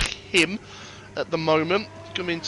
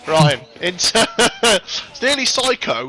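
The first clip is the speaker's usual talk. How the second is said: slow call broken by laughs; laughing; 4 semitones higher, 4 dB louder, slower than usual. Real, not acted. Commentator laughing while introducing the next lap, laughing. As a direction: try to commentate through a giggle.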